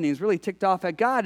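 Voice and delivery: usual speaking voice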